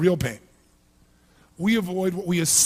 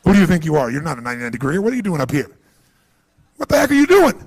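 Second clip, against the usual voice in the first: loud, indignant telling-off from your own brain; louder, sharp mock rebuke to yourself; scolding inner voice; 2 semitones lower, 8 dB louder, faster than usual. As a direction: scold yourself like an outraged boss. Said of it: "your brain goes".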